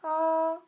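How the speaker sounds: "A" held high and loud without moving; high level tone. Shown as a demonstration. Teacher repeats the first tone, "A" high and level. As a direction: sing "A" on one high note. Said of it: "C'est très plat".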